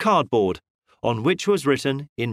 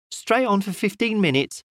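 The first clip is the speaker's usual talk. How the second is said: lightly voiced chatty shopwoman, higher; a hint of a village shopwoman, pitched up; doubtful shopwoman; 6 semitones higher than usual. A narrator's reading. Shopwoman explaining to the detective, lightly voiced higher. Woman gives directions.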